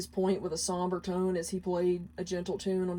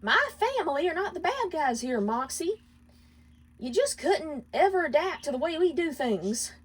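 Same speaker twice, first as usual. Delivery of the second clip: higher voice, defensive and stubborn; arguing wife; 9 semitones higher than usual. Acted. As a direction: defend her family with a huff. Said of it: "Millie argued".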